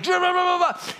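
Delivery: high, loud hammed-up ranting; dad pointing at son, mock yelling. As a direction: rant in gibberish at the kid. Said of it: "I'm going at him".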